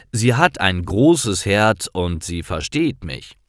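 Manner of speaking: ordinary talk, nothing marked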